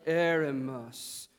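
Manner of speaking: "Eremos" drawn out very slowly; slowed down. Shown as a demonstration. Preacher has the congregation try again, slowed down. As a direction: stretch "Eremos" like a learned scholar. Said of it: "slow it down and maybe put on".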